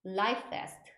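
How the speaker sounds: "life vest" joined smoothly into one word; linked pronunciation. Shown as a demonstration. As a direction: link "life" into "vest" with no break between. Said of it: "이렇게 연음으로 발음해 주시면 돼요".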